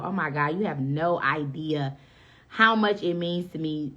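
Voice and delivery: ordinary talk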